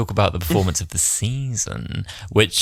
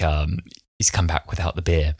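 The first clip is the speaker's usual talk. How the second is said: normal talking voice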